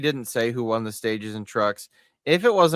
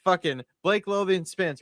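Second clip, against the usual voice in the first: ordinary delivery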